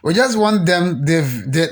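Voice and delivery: usual speaking voice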